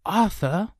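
put-on high-pitched boyish caution; warning. A narrator's reading. Brother cautioning the younger, warning. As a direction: caution him without raising volume. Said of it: "Kay warned quietly".

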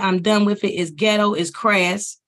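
ordinary talk

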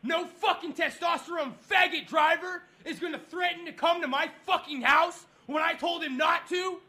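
high-pitched, indignant tirade; furious rant. Real, not acted. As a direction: rant in real outrage about the threat. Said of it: "I am fucking furious".

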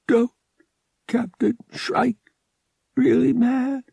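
put-on high, halting, feverish boy; effortful speech. A narrator's reading. Swimming-headed boy asking about the captain, effortful speech. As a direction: push out each word slowly with great effort. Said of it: "he made a great effort".

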